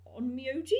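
"Onmyoji" as a high, rising question; tentative go at "Onmyoji", pitch climbing to the end; unsure pronunciation. Real, not acted. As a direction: say "Onmyoji" as if asking whether it's right. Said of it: "I'm gonna butcher the pronunciation of this".

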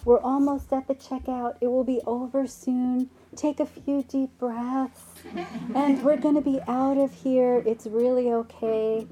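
sing-song reassurance, held high; gentle mom. Retold or imitated. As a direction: coax a demanding child along patiently. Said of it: "very gently and kindly says".

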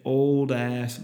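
ordinary talk, nothing marked

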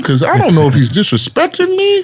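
high-pitched, fast mock outrage; retold indignation. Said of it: "I was like".

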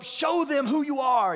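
slow, loud, high rallying cry; psyching himself up. Retold or imitated. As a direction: stretch it out like a self pep talk. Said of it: "trying to psych myself up".

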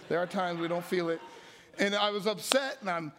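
ordinary talk

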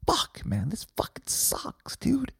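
drawn-out whispered complaint; mock-despairing whisper. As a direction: grumble it in a defeated whisper. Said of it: "And then you realize".

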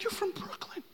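high, hushed squeak of disbelief; mock incredulity. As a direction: squeak it softly, as if baffled. Retells his incredulous reply to Lisa.

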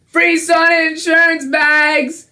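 high, drawn-out sing-song call; embarrassed sales pitch yelled high and stretched; cringy hawker's yell. Retold or imitated. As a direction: holler it slowly like a reluctant street vendor. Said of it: "I had to yell out".

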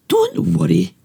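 very high little mouse peep. Acted. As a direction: reassure the lion in a tiny peep. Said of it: "Mouse peeped".

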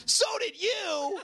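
mock kid voice, shouted and slowed; bratty kid comeback. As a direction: fire it back like a cheeky eight-year-old. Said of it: "And he goes".

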